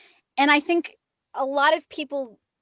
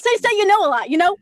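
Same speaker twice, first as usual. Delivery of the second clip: higher, louder Jersey imitation, giggling; giggling Jersey coach; 8 semitones higher, 8 dB louder, faster than usual. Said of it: "giggle like that".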